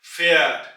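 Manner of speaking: फैट with a flat ए, said loud and clearly; demonstrated pronunciation. Shown as a demonstration. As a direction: model फैट slowly for learners. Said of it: "ए की आवाज निकलेगी". (फैट को साफ़ चपटी ए से बोलें)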